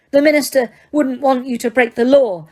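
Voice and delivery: high, loud desperate protest; pleading girl. Acted. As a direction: beg her to stop. Hermione pleading.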